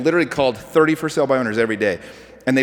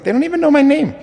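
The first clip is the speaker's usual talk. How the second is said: high-pitched incredulous; 14 semitones higher, 5 dB louder than usual. Retold or imitated. Retold reply to nervous trainee.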